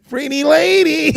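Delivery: high, hammed-up comedian voice; goofy high-pitched impression of a comic; Jerry Lewis. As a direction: squeal it like an old slapstick comedian. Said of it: "He sound like Jerry, Jerry Lewis".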